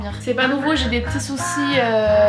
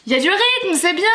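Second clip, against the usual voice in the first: teacher praise; 7 semitones higher, 3 dB louder than usual. Pretend.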